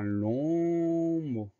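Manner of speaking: LONG held on one stretched vowel before MOT; drawn-out word. Shown as a demonstration. Teacher announcing a long word, LONG playfully drawn out.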